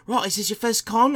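high mock-British question; British accent. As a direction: imitate a chummy British bloke. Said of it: "British guy".

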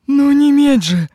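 loud, high scoffing retort. Acted. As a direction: toss it off with a scoff. Witch scoffs at the question. (насмешливо и громко)

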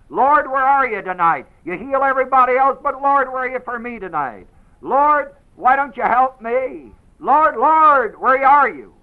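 high, loud, pleading wail; desperate crying to God. Retold or imitated. Weak believer crying out to an absent Lord, loud and pleading. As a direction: beg the Lord at full voice. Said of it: "believers may cry".

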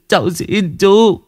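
high, slow, stretched begging; drawn-out plea. Acted. Boy begging Vũ, slow high plea. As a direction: drag out the last plea.

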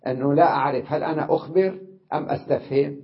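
ordinary talk

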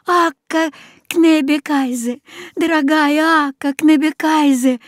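high, joyful cry of the name; joyful call. A narrator's reading. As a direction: call out to her in relief. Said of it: "радостно закричал я".

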